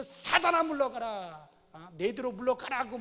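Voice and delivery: loud, high command; stern rebuke. Retold or imitated. Jesus rebuking Satan, loud stern command. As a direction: order Satan away with force. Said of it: "우리 예수님께서".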